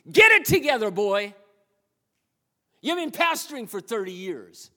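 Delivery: high, loud, exasperated telling-off; hammed-up scolding, punching the key words; mock scolding God. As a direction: play an exasperated God dressing down a pastor. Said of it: "he looks at you and goes".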